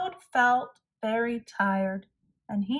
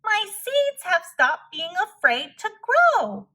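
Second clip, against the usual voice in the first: loud, much higher cry of relief; shouted with joy; 9 semitones higher, 6 dB louder than usual. Acted. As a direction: burst out in triumph. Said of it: "shouted Toad".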